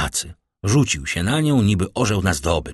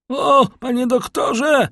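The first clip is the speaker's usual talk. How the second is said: high, drawn-out cry of distress; a stricken man calling out for the doctor; Barua crying out; 4 dB louder, slower than usual. A narrator's reading. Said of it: "wykrzyknął Barua". (wysoki, rozpaczliwy okrzyk)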